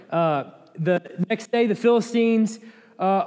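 ordinary delivery

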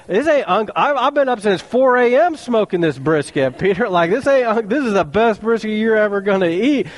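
preacher voicing God defending his brisket, mock-indignant; God as pitmaster. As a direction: playfully insist the brisket is the best.

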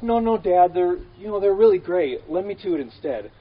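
eager son talking his father down; voicing Jesus. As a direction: beg Dad to let him go instead. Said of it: "Jesus is like".

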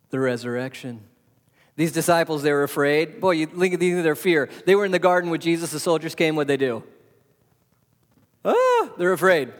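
ordinary delivery

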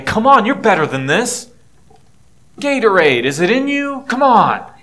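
loud, high-pitched exhortation; mock pep talk. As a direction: egg them on like a hammed-up motivator. Mock pep talk God never gave.

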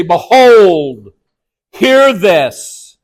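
weighty call to attention on Behold and hear; emphatic command. Retold or imitated. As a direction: hit Behold hard, pause, then a firm hear this. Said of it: "you hear God say".

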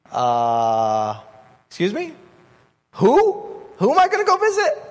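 high, fast, squeaky panic at the name; alarmed disbelief. Pretend. Ananias hearing God name Saul, alarmed and squeaking. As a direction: pitch jumps up in nervous disbelief. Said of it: "his blood pressure went up".

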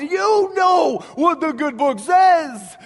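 loud, high, slow mock cry to heaven; hamming up Tevye calling out to God. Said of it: "in his prayer to God, he says".

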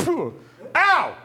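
hammed-up yelp of pain, high and loud, laughing; mock cry of pain. Commander mimicking being shot, laughing yelp. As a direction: play the fake-shot commander yelping. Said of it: "he was like".

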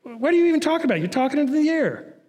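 high-pitched mocking heckle; Satan. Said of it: "Satan says".

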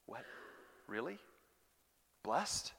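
soft questioning, "Blessed" rising at the end; quiet disbelief. Acted. Preacher doubting that the persecuted are blessed, quietly incredulous. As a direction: puzzle over it in short, separated questions.

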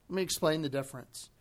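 ordinary talk

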